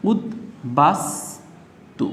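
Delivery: "উদ বাস তু" as ud, bas, tu with pauses; s sound, split syllables. Shown as a demonstration. Teacher showing it is said like S, split syllables. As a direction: clear s ending "বাস", each syllable separate. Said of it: "এস এর মতো উচ্চারিত হচ্ছে".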